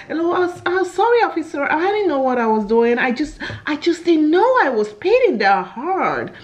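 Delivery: high-pitched fake American accent, tongue-twisting; put-on American accent. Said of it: "Don't try and do tongue".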